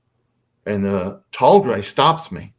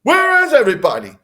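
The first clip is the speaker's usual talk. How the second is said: high, loud retold outburst; angry dad; 6 dB louder than usual. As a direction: imitate an angry father hollering through the house. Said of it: "And he's angry".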